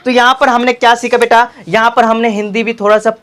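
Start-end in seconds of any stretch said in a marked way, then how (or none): none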